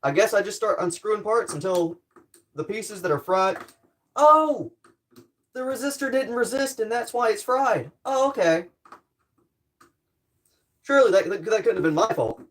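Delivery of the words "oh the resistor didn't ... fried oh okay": high, drawn-out "oh" of discovery; sudden realization. Real, not acted. Tinkerer discovers the fried resistor, high-pitched realization. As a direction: pitch jumps up as it dawns on you.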